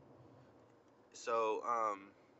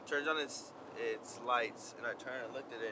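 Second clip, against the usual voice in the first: ordinary talk, nothing marked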